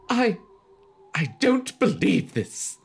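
high, slow mock-mom voice; exasperated mom in disbelief; hammed-up horrified mother; appalled mother.

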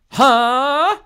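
very high, loud startled "huh"; surprised. Acted. Halle accused by staring Tracy, surprised. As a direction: squeal it in exaggerated surprise. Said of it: "eyes widened in surprise".

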